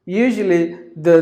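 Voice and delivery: ordinary delivery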